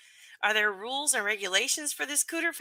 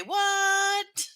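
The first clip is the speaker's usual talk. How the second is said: high squeaky what; 11 semitones higher than usual. Pretend. Streamer voices the festival cooters with a high startled what. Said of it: "All the cooters in the house say".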